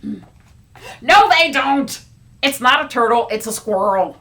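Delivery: loud insistent quarrel; loud childish argument; arguing. Acted. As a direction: shout him down. Characters quarrel.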